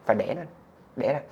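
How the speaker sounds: ordinary talk, nothing marked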